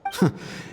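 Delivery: high, scornful burst of laughter; mocking laugh. Elder laughing off the rivals' questions, scornful. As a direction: laugh down at their puerile questions.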